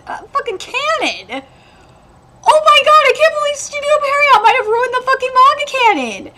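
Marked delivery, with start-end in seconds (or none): 2.4-6.3 angry outburst. Real, not acted. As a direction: vent the anger again. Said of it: "I'm so mad".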